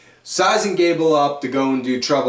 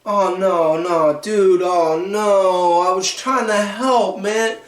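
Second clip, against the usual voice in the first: dismayed Woods; 5 semitones higher, slower than usual. Retold or imitated. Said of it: "Woods is looking like".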